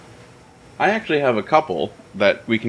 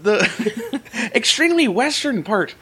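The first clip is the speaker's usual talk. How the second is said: hammed-up Irish lilt, pitched higher; mock Irish accent; 10 semitones higher than usual. Host teasing John in a mock Irish accent. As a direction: put on a jokey stage-Irish voice. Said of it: "I'm not doing this voice".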